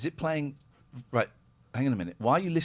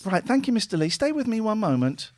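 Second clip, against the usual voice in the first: high polite call-centre woman; sweet high operator tone; high lady voice; 9 semitones higher, 4 dB louder than usual. Acted. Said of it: "I'm a lady".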